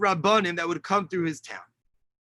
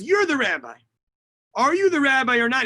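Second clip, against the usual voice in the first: raised, higher-pitched challenge; retold interrogation; 5 semitones higher, 4 dB louder than usual. German officer confronting the rabbi, retold demanding questioning. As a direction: press the question, imitating an interrogator.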